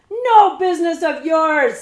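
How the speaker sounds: loud, high snarl. A narrator's reading. Cutwater snarls at the girl to keep away. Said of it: "snarled Cutwater".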